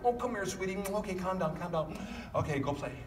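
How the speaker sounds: put-on calm the kid down quickly and brush it off; soothing parent. Parent shushes a crying daughter, soft and soothing. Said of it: "This is what we do".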